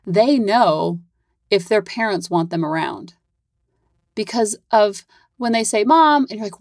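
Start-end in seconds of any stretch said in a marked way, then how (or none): 5.8-6.3 put-on high, loud call of a kid; child calling mom.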